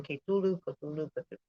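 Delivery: Cthulhu as kay-TOO-loo, fading; alternate pronunciation. Shown as a demonstration. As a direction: try Cthulhu several ways. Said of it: "Others use pronunciation".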